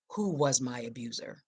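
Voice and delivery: ordinary delivery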